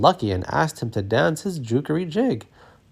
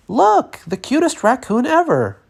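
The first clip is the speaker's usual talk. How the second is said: put-on delighted child's squeal, much higher and louder; excited scream; 13 semitones higher, 4 dB louder than usual. A narrator's reading. Child spotting the raccoon, delighted high squeal. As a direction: shriek with delight at the cute raccoon. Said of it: "turned and screamed".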